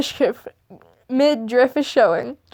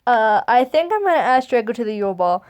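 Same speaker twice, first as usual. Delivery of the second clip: normal talking voice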